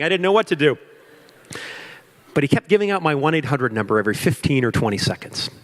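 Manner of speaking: ordinary talk, nothing marked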